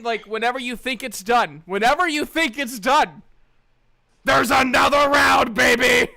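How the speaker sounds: rising mock hype to a yell; building to a shout. Joking about toilet ASMR, builds to a loud hype shout.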